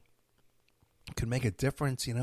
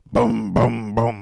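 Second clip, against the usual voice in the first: booms hit much louder, like hammer blows; loud imitated head pounding; 2 semitones lower, 12 dB louder, much slower than usual. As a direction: slam out each boom like a headache spike. Imitating his pounding headache.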